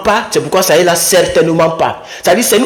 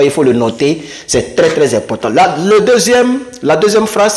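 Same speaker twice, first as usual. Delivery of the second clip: ordinary talk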